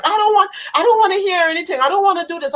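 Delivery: high, fast pleading protest; distraught refusal. Retold or imitated. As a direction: cut him off in desperation. Refusing husband's explanation, distraught.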